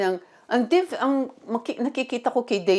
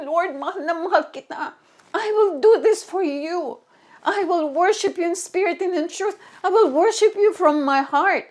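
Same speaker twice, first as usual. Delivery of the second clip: fervent prayer; 5 semitones higher than usual. Shown as a demonstration. Modelling a prayer to God.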